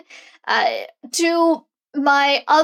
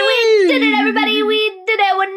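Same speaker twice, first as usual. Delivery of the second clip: excited cheering; 7 semitones higher than usual. Real, not acted. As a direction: celebrate like you just won. Cheering the podcast start.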